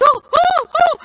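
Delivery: very high, loud flustered oh; mortified old lady realizing her words; embarrassed gasp. Retold or imitated. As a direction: squeal out an embarrassed oh oh oh. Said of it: "she turns all red".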